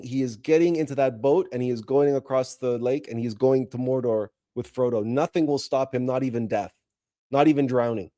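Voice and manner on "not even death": normal talking voice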